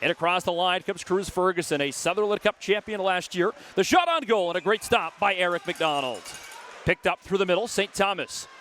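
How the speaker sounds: quick call that lifts and shouts on the goal; live play-by-play. Acted. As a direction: call the action as it happens.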